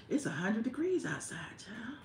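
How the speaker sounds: breathy whisper, half to herself; whispered aside. Real, not acted. To viewers about Atlanta heat, hushed whisper. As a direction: confide the heat complaint under her breath.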